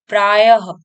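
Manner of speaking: "प्रायः" ending in a loud ha; visarga h sound. Shown as a demonstration. Teacher showing the h coming out, punched ha in "प्रायः". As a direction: say "प्रायः" as praa-ya-ha, forcefully. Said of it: "तो ये ह का".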